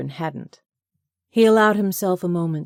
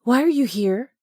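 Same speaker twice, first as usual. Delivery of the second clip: higher, louder, sharp irritated question; irritated demand; 8 semitones higher, 6 dB louder than usual. A narrator's reading. Woman confronts her ex, irritated and louder. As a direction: demand it, bristling at him. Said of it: "an intense look that bordered on irritation".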